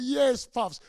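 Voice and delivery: loud, high cheer of Yes, pups. Retold or imitated. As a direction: cheer them on. Elders cheering.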